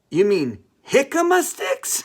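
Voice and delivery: put-on loud, high, incredulous question in a Spanish accent.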